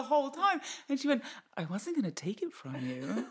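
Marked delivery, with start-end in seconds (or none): 1.6-3.3 light American accent imitation. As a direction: slip into an easy American accent. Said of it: "went back to her American accent".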